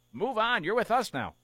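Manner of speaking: mock exasperated push. Host voicing teammates telling Freeman to move on. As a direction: push him to move on, teasing. Voicing Kershaw's annoyed message.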